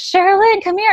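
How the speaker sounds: high-pitched, eager summons; imitating a friend calling out. Retold friend calling her over.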